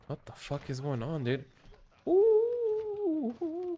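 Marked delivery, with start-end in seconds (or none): 2.1-3.8 very high drawn-out ooh. Real, not acted. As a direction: let out a high squeaky ooh. Reacting to game moment.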